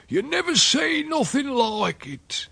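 put-on very high, slow, drawn-out old countryman; heightened rustic amazement. A narrator's reading. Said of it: "and grunted".

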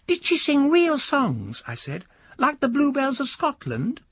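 put-on a high, eager boy begging his uncle; the nephew keeping the old man awake; young boy nudging sleepy uncle, high and pleading; young boy's voice. Boy asks about songs.

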